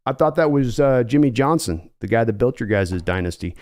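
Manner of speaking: lowkey John Schneider on the phone; low, weary team executive; calm Seattle GM. Acted. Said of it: "give John Schneider".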